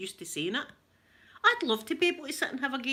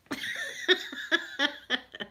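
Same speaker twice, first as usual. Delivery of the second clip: real laugh at herself; self-mocking laugh; laughter; 5 semitones higher than usual. Real, not acted. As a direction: chuckle at your own joke. Laughing at own joke.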